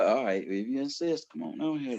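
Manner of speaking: soft, unbothered; calm invite. Retold or imitated. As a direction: lure them in quietly. Calm confident reply.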